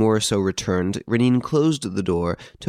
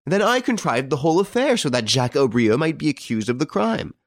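high-pitched, amused disbelief; 7 semitones higher than usual. A narrator's reading. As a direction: toss the accusation back as a joke, voice lifted. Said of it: "the air of being frankly amused".

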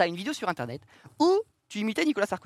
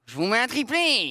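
Mbappé impression; 3 semitones higher, slower than usual. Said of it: "Mbappé".

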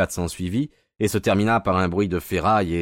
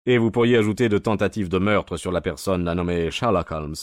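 usual speaking voice

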